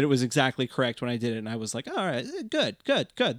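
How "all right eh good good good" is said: high little voice of self-approval; pitched way up, quick little self-congratulation; pleased self-talk. Retold or imitated. Player to herself after a correct play, pleased.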